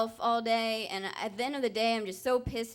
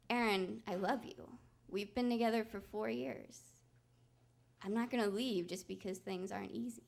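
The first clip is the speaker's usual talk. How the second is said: much softer, gentle and steady; tender reassurance; 2 semitones lower, 10 dB quieter than usual. Retold or imitated. Quiet loving promise. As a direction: Justin refusing to leave her, soft and tender.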